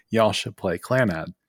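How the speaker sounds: ordinary talk, nothing marked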